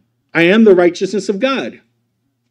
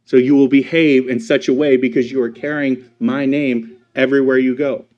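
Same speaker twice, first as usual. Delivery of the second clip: normal talking voice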